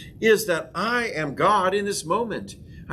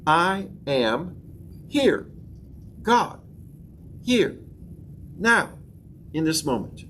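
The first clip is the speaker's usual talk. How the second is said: proclaiming; slower than usual. Shown as a demonstration. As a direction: call each word out with rising fervour. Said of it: "understand to say".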